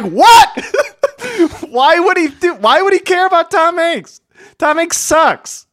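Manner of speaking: high-pitched, laughing disbelief; incredulous. Retold or imitated. Reacting to a film line that triggered the speaker, incredulous. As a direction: squeal it in baffled, amused outrage.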